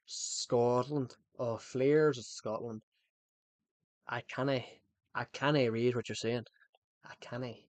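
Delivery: light put-on Scottish accent; a hint of Scottish, cannae rolled out. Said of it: "I like to do the Scottish accent".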